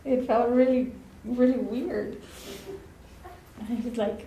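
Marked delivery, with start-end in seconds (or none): none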